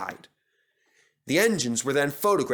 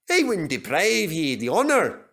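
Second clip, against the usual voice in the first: lightly Scottish Donald; 5 semitones higher, 3 dB louder than usual. A narrator's reading.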